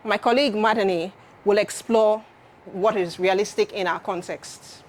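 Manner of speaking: ordinary talk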